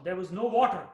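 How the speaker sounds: normal talking voice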